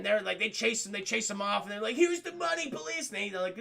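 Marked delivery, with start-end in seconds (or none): 2.0-3.1 high-pitched pleading crook; panicky surrender. Acted. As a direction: give up in a high, mock-frightened voice.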